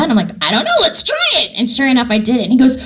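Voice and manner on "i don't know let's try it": high, eager outburst; game. Retold or imitated. Actor answering the director's doubt, eager. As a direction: blurt it out high, up for anything.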